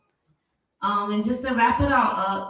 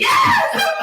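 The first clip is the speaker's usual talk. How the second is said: high, loud excited yell; excited yes; 7 dB louder than usual. Real, not acted. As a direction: shout it out with joy. Excited cheer at friend's joke.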